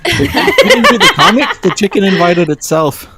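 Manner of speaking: talking through laughter; laughing. Real, not acted. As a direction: giggle the words out. Laughing banter.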